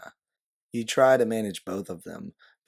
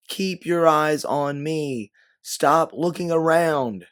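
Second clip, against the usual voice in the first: slow, higher and louder commands; yelling; 8 semitones higher, 8 dB louder, slower than usual. A narrator's reading. You yell at Yanine to stop looking around, slow and loud. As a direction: bark each word so she listens. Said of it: "you yell as you refocus".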